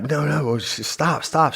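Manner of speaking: high-pitched urgent nurse voice; high, urgent plea to halt; urgent alarm, imitated. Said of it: "they all got fucking serious".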